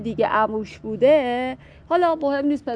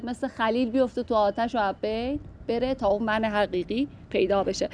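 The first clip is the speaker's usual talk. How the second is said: ordinary delivery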